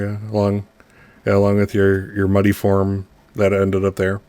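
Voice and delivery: ordinary talk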